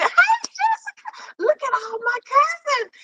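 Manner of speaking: thrilled by all the cousins showing up; excited squeal. Real, not acted. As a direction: squeal her name in delight. Greeting viewers, very excited.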